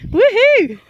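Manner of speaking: high, loud whoop; cheering him across; excited whoop. Real, not acted. Whoop at the partner's swing.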